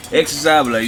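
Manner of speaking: normal talking voice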